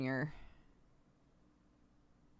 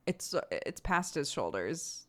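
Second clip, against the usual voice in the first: normal talking voice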